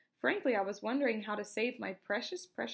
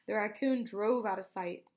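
ordinary delivery

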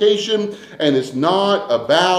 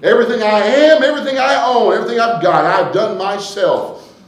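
proud, bragging voice, pitched higher; boastful self-made man; 6 semitones higher, 5 dB louder than usual. Retold or imitated. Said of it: "there are a lot of people that, that say".

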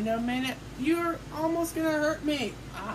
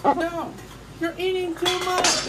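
shouting; 5 semitones higher, 5 dB louder than usual. Pretend. Toy character refuses more food.

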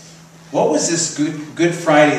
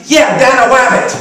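put-on high, loud little-girl voice, laughing; toddler voice; 10 semitones higher, 7 dB louder than usual. Pastor voicing the toddler about the Easter Bunny, high and laughing. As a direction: squeak it like a delighted two-year-old.

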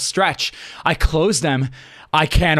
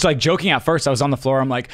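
usual speaking voice

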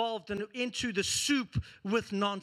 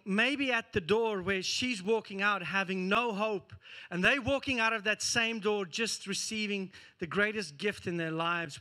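normal talking voice

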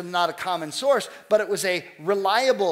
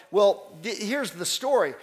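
ordinary delivery

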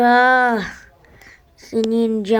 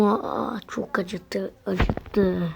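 pained whimper; 2 semitones lower, 7 dB quieter, much slower than usual. Acted. Remembering burning pain.

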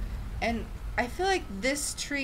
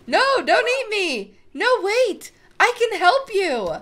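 higher, louder, panicked pleading; pleading hero; 10 semitones higher, 10 dB louder than usual. Acted. Hero begs the toad mother, loud and pleading. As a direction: beg not to be eaten. Player character begs for life.

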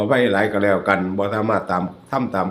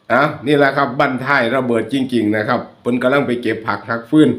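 ordinary delivery

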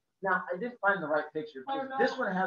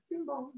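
higher, soft sung doorbell chime; doorbell imitation; 8 semitones higher, 8 dB quieter than usual. Imitating the doorbell, soft sung chime. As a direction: sing the doorbell.

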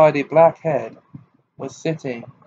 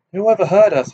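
higher, louder demanding question; 7 semitones higher, 5 dB louder, faster than usual. A narrator's reading. Harry asking Sirius who overheard them, demanding. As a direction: demand to know, raising the voice a little. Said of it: "Harry demanded".

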